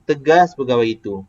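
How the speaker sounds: front-loaded "Tegas" with falling "pegawai itu"; inverted-sentence tune. Shown as a demonstration. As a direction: hit "Tegas" hard, then drop "pegawai itu". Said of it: "Tegas tinggi".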